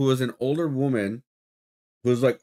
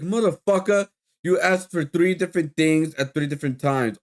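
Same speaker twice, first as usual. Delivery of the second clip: higher, fed-up outburst at him; exasperated rant; 5 semitones higher than usual. Real, not acted. Fed up with a customer who asked three times, exasperated. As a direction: blow up at the customer's nerve.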